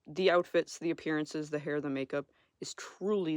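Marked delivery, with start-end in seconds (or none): none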